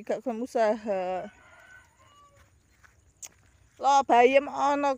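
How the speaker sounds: normal talking voice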